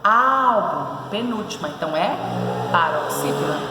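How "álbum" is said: Álbum with a long, strong ÁL; stressed syllable stretched. Shown as a demonstration. Teacher showing the tonic syllable, Álbum with ÁL held. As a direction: lean on the ÁL in Álbum. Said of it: "Qual é a posição da sílaba tônica?".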